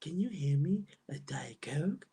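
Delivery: slow, breathy mock whisper; imitating a YouTuber's whisper, slowly; Life by Jen whisper. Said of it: "that's how Life by Jen talks".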